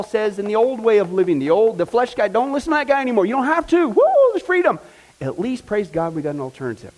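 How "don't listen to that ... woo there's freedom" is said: from urging to a whooping shout of joy; excited build. Real, not acted. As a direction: build to a cry of freedom. Preacher rises to joyful climax.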